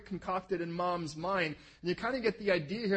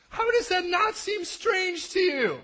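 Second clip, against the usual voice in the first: normal talking voice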